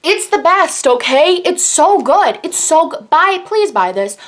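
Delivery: fired-up beauty rave to viewers; emphatic pleading rave. Real, not acted. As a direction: sell it hard, begging them to buy. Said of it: "I'm getting angry about it".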